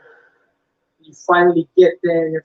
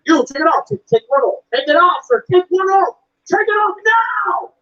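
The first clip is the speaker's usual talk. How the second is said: mock yelling guard; 10 semitones higher than usual. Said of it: "this man shouting at you".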